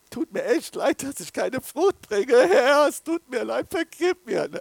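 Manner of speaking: loud, high wail for forgiveness; begging cry. Retold or imitated. Voices a believer's begging prayer.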